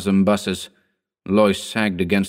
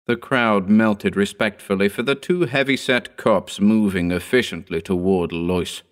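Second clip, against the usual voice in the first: plain reading voice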